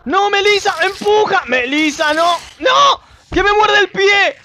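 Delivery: high, loud frantic yells; panicked shouting. Real, not acted. Player yelling at Melissa while a zombie bites, panicked shout. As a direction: scream for help as it bites.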